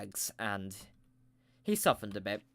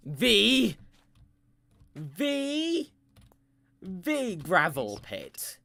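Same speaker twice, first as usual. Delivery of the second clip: very slow, high-pitched build to the reveal; mock-epic unveiling of the gravel pit; dramatic reveal; 6 semitones higher, 6 dB louder, much slower than usual. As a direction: draw it out for suspense.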